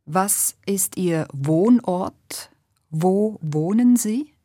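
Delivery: ordinary talk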